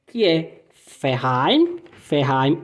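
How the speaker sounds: Verein said slowly as ferain, V as F; scene: teacher slowly models V sounding like F in Verein; German Verein with F. Shown as a demonstration. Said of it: "Pronuncia com som de F".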